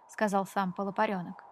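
ordinary delivery

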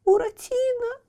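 high tearful frightened call; frightened crying. Acted. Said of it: "пугливо оглядываясь". (испуганный плач Пьеро)

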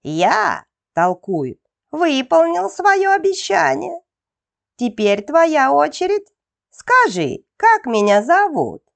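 high, smug gnome voice; a gloating little gnome; gnome's voice. A narrator's reading. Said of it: "Громко захихикал карлик". (высокий самодовольный голос карлика)

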